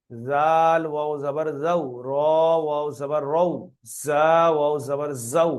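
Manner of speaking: slow, chanting Quranic letter drill, each syllable drawn out; slow lesson chant; drilled spelling recitation. Shown as a demonstration. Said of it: "এখানে উচ্চারণ করতে হবে".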